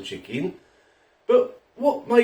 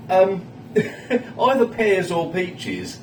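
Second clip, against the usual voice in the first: giggling; 5 semitones higher, much slower than usual. Real, not acted. Giggling about tinned fruit habit.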